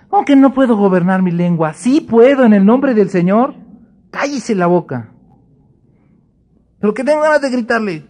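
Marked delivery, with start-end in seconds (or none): 0.1-3.5 high, loud triumphant declaration; defiant exclaim. Acted. Believer claiming victory over the tongue, triumphant shout. 6.8-8.1 fast, high pleading whine; whiny complaint. Acted. Believer whining to God about wanting to yell, fast whine.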